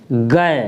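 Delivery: गै said as "gai"; teacher sounding out "गै"; ga with ai matra. Shown as a demonstration. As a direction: model "गै" clearly. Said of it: "तो क्या पढ़ेंगे?".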